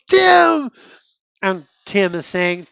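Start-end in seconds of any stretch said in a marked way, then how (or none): 0.1-0.7 high, loud cry of a name; startled shout. Acted. Nightwing turns around and sees Tim, startled high shout. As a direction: shout his name in sudden shock.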